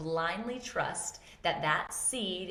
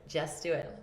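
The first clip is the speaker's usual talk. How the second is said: ordinary talk, nothing marked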